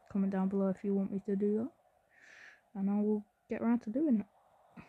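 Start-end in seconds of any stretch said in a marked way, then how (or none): none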